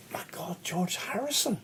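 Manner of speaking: whispered "My God" in disbelief; starstruck whisper. Retold or imitated. Recognising [name] at the table, starstruck whisper. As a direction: hush it like you can't believe your eyes.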